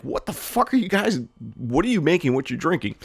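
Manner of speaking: high, mock-baffled quote; incredulous. Narrator quoting herself at the Dunkin' counter, incredulous. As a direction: act out a lightly voiced disbelief. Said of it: "I'm like".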